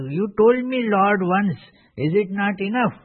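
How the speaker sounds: mock impatient complaint. A listener grumbles to the Lord, mock impatient. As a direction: sigh it out like a bored pupil.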